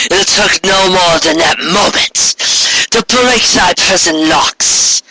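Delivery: tower over a fallen sister; Corona, proud and mournful. Acted. Corona circles Luna.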